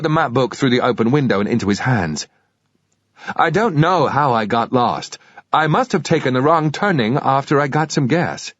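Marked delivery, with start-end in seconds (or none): 3.3-8.5 put-on a Brit's careful American accent. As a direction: fake American tourist asking for help. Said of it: "My American accent was quite good".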